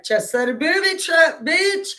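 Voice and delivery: high, loud cocky brag that climbs on bitch; gleeful boast. Retold or imitated. Bragging to her brother about the booby trap, a high gleeful crow.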